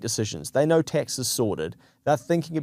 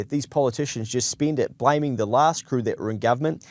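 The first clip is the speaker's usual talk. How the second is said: normal talking voice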